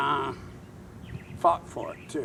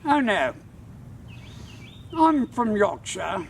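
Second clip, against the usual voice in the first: put-on stern old Brit insisting, pitched up; stern British man; 7 semitones higher than usual. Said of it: "looks at me very sternly and he says".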